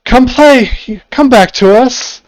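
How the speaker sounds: high, loud sing-song calling from the mist; luring fog women. Acted. Unseen women in the fog luring the party, loud high calling. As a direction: call them back sweetly, like sirens in the fog. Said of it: "the playful calls of several women in the fog".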